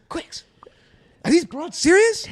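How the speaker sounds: high-pitched mock disbelief; hammed-up high incredulous question; incredulous squeal. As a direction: imitate a friend squeaking in outrage. Imitating friend's indignant reaction to being mocked.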